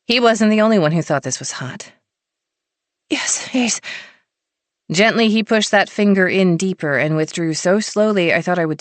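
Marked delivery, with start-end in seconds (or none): 3.1-3.8 high whispered yes; breathless whisper. A narrator's reading. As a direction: gasp it out barely voiced. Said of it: "I barely got my answer out".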